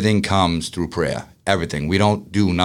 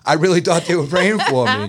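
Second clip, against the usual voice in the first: laughing through it, pitched up; 8 semitones higher than usual. Real, not acted. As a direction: laugh at your own mistake while you say it. Laughs at his own naivety.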